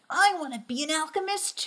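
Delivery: put-on high, eager little-boy voice; wholesome 1950s kid. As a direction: beg like a hopeful kid fan. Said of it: "He's like".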